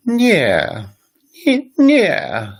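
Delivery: "Nie" starts very high, then swoops down; high then dropping. Shown as a demonstration. Teacher showing a Polish negative answer, "nie" really high dropping down. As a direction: hit "nie" high and loud, then let it fall steeply. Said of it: "really high and it drops down".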